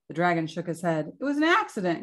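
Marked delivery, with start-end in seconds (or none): 1.2-2.0 high, protesting exclamation; mild storybook roar. A narrator's reading. Dragon defending itself to king, mildly roaring. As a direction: protest your innocence, pitch jumping up. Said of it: "he roared".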